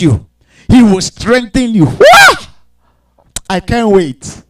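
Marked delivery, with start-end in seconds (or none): 1.9-2.4 loud high wail. Acted. Mock wail before joy.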